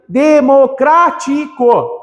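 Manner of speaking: Democrático drawn out slowly, each syllable separated; de-mo-crá-ti-co said slowly. Shown as a demonstration. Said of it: "Separa as sílabas".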